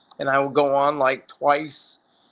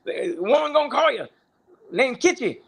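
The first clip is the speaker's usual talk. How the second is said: high, bossy friend's voice; 10 semitones higher than usual. Retold or imitated. Friend telling him to shut up, high and cocky. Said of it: "So he goes".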